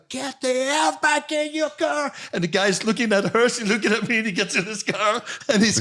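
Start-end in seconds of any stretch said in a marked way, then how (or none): 0.1-2.1 high, slow, commanding; daughter yelling. Retold or imitated. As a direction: imitate the daughter ordering him back. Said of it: "there's no sound".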